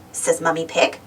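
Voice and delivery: plain reading voice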